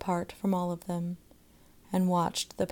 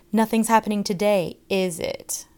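loud mocking question; 3 semitones higher, 8 dB louder than usual. A narrator's reading. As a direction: goad the others loudly. Boy goads other children.

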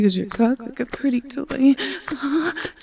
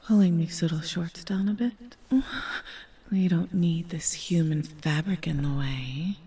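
soft, low unvoiced whisper; 6 semitones lower, 7 dB quieter than usual. Lover whispering to her partner, hushed whisper. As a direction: breathe the words close to the ear. Intimate roleplay, whispered aside.

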